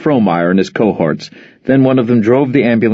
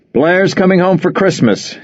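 blurted; 9 semitones higher than usual. Acted. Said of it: "he blurted".

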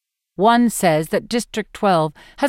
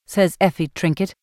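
plain reading voice

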